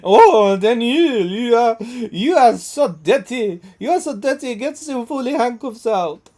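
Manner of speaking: high, loud mock French mistress; French accent. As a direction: put on a hammed-up French accent. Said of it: "like, a French accent".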